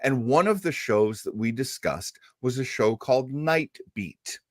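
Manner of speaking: ordinary delivery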